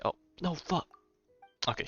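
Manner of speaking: ordinary talk, nothing marked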